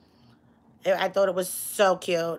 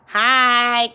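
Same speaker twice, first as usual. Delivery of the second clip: ordinary talk, nothing marked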